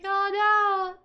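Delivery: high, loud cry of "Oh no!"; surprised gasp. Retold or imitated. Voicing the shocked sisters, a high loud "Oh no!". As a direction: caught off guard and alarmed. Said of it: "It's like".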